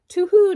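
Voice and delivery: high, loud owl hoot. Acted. Owl answers in rhyme story.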